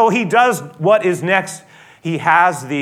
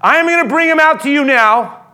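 loud, raised voice calling out to a crowd; Pilate addressing crowd; 6 semitones higher, 5 dB louder than usual. Retold or imitated. Pilate calls to the people outside, loud and pleading. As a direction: announce it to the whole crowd, high and loud. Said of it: "he says".

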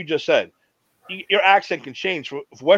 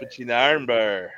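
Stu Hart; 3 semitones lower than usual. Retold or imitated. Joining in a friend's impression of an old wrestler, drawling imitation. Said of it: "They talk like Stu Hart?".

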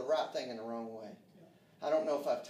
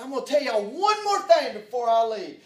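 retelling a furious yell, much louder and higher; angry shout; 11 semitones higher, 18 dB louder than usual. Retold or imitated. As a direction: relive the moment he lost his temper, shouting. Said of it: "I said it with anger in my heart".

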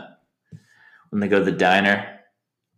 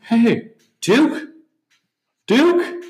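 much higher, calling voice rising; imitated photographer calling; 4 dB louder, much slower than usual. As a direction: call out searchingly, pitched up.

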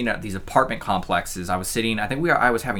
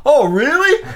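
high, loud mock amazement; eager neighbor; 8 dB louder, slower than usual. Neighbor on the porch answering the yell, eager mock voice. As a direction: imitate an older man suddenly very interested.

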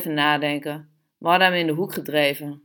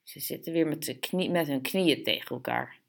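hushed, much softer aside; disgusted whisper; 2 semitones lower, 8 dB quieter than usual. A narrator's reading. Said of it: "trok hij een vies gezicht en fluisterde". (zacht, vies fluisterend)

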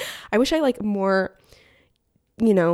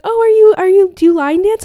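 excited question; 11 semitones higher, 9 dB louder than usual. Retold or imitated. Seller excitedly asks about dancing.

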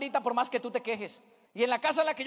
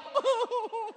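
high-pitched fake sobs; mock child crying; 9 semitones higher, 3 dB quieter than usual. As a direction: keep up a hammed-up childish whimper. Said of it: "Que deje de llorar".

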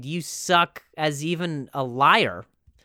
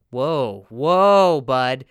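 mock alarmed whoa; 8 dB louder, much slower than usual. Real, not acted. Reacting to scammer's "my love.

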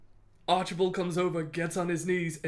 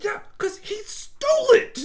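very high, loud hammed-up sneer; mocking retort; 9 dB louder than usual. Ramsay retorts to the guards, loud mocking sneer. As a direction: spit it back mockingly. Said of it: "he's like".

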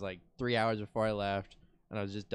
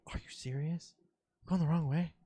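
higher, incredulous questions; genuine shock, retold; 5 semitones higher, 3 dB quieter than usual. Driver to the cops who stopped them, retold shock. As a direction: replay your disbelief, rising in pitch. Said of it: "my genuine shock".

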